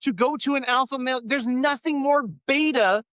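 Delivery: high, heated, incredulous rant; excited rant. Real, not acted. Host ranting to his co-host about paid alpha camps, high and heated. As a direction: push the pitch up in disbelief.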